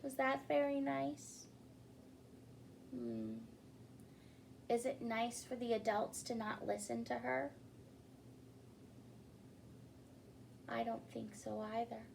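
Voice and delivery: put-on soft, gentle question to young listeners; asking children. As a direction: check in with the little ones.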